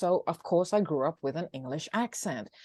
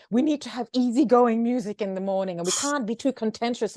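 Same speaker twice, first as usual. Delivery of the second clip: mimicking complaint; 6 semitones higher than usual. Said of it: "they complained about this".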